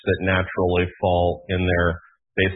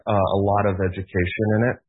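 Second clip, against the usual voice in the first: ordinary delivery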